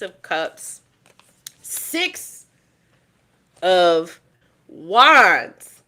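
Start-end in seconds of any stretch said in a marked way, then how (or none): 1.6-5.8 dramatic card reveal. Real, not acted. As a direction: build suspense, then blast the card name.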